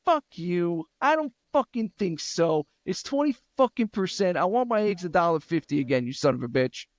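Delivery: high, indignant swearing; angry outburst. Real, not acted. Host cursing at rising grocery prices, high and heated. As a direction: tell them off.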